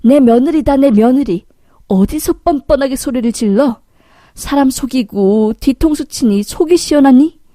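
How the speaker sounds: acted outraged confrontation; angry shouting. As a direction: step in and shout the cheat down. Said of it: "라고 소리 지르자".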